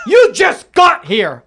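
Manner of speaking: loud, high comic shouting; worked-up mock-outraged rant; indignant outburst. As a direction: yell in exasperation at the newcomers. Railing at the Australian newcomers.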